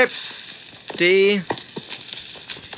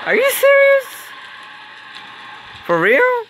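shocked disbelief. Real, not acted. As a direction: squeal it in disbelief. Teammate shocked by betrayal.

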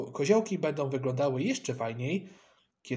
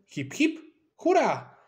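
high, loud group-style hurrah; shouted cheer; 10 semitones higher, 4 dB louder, slower than usual. A narrator's reading. The boys cheer the great idea, shouting. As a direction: whoop it out, pitch leaping up. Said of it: "więc wykrzyknęliśmy".

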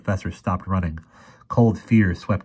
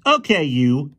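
loud, high call-out; 7 semitones higher, 5 dB louder, slower than usual. A narrator's reading. Fergie confronts the armed boy, loudly. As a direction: challenge him loudly. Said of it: "he said loudly".

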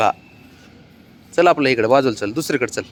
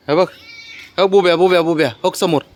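calling out; 6 semitones higher, 4 dB louder than usual. Real, not acted. Calling the pet to look.

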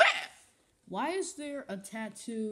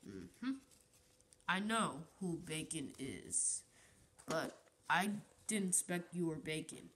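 normal talking voice

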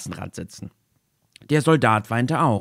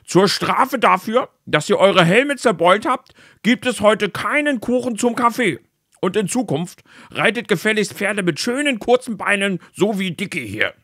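king calling out; 10 semitones higher, 4 dB louder than usual. Acted. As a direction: proclaim it sternly to the guards. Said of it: "rief er den anderen Soldaten zu". (König ruft den Soldaten laut und hoch zu)

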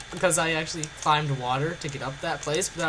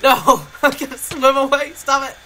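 panicked yelling; 7 semitones higher, 9 dB louder than usual. Real, not acted. Being hit with spoon.

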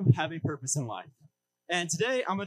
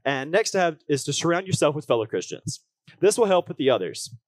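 upbeat youth pastor; 5 dB louder than usual. Acted. Preaching to the congregation.

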